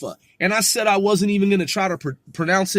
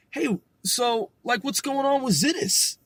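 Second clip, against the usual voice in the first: imagined casual question; 7 semitones higher than usual. Pretend. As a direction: pretend small talk, pitched higher. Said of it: "I'm gonna be like".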